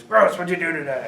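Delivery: loud, clumsy British boss impression; bad British accent. Tough boss checking on his salesman, poor British accent. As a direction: attempt a British accent and miss. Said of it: "I can't do a British accent".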